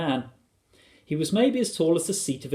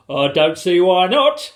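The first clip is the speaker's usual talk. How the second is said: loud, slow, raised-pitch reply; booming, confident storybook father; hearty husband; 6 semitones higher, 10 dB louder than usual. A narrator's reading. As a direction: answer boldly and deliberately.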